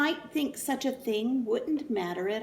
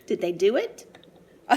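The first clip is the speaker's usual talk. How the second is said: usual speaking voice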